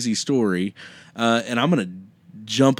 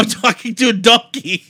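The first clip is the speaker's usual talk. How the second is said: high, loud incredulous voice; mock astonishment; 10 semitones higher, 5 dB louder than usual. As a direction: play the dawning shock, hammed up. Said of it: "So, so funny".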